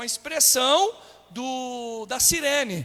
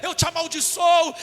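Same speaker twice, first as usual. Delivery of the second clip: high, loud angry curse; forceful acted malediction; acted cursing; 6 semitones higher, 8 dB louder than usual. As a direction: hurl the curse at the accuser. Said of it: "Ele começou a amaldiçoar as pessoas".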